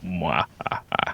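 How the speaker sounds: slow, low evil mwahaha; a quiet villain's cackle; mad scientist. Pretend. Alone on air, evil laugh.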